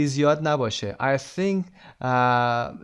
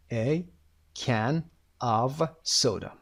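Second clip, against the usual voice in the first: "A", "can", "of soda" split apart with long pauses; word by word; much slower than usual. Shown as a demonstration. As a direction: spell it out slowly, word by word. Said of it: "اگر بخوایم واحد رو دقیق تر بگیم".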